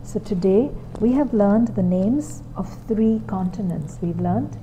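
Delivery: put-on soft, patient classroom voice to a small child; gentle teacher voice. Shown as a demonstration. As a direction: guide the child calmly through the lesson. Teacher's demo lesson with child.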